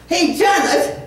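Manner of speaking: lightly voiced British son-in-law, teasing; a hint of a British accent, slow on the last words. Son-in-law teasing Janet at supper, light British accent. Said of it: "in his British voice".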